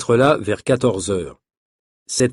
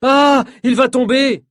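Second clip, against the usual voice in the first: high, loud, drawn-out cry of panic; alarmed cry; 13 semitones higher, 5 dB louder, slower than usual. A narrator's reading. Nina sees the performer about to fall, alarmed cry. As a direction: cry out in alarm, slowly stretched. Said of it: "crie Nina".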